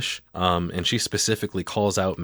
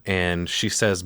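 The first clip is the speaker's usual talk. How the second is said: normal talking voice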